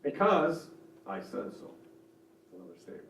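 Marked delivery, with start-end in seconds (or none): 0.0-1.7 a hint of a stern parent, slow; slow, measured parental put-down; authoritative parent. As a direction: lightly play the parent closing the argument. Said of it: "some examples of a parent voice".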